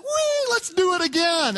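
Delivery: very high gleeful squeal, then eager; thrilled little boy. Retold or imitated. Son delighted after the bump, very high excited kid voice. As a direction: squeal like a kid who loved the ride. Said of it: "He's like".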